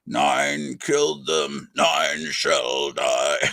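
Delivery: very slow, drawn-out villain intonation; imitated horror villain pronouncing a curse; Doctor Phibes. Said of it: "his answering machine was Doctor Phibes".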